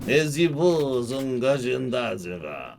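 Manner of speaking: slow, hammed-up fake French with a chuckle; exaggerated movie Frenchman accent; mock French gibberish, drawn out; Hollywood French accent. Shown as a demonstration. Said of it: "I can do Hollywood French accent".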